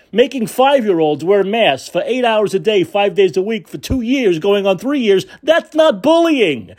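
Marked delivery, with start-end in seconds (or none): 5.4-6.7 sarcastic. Retold or imitated. Sarcastic mock of critics.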